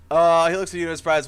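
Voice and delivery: ordinary talk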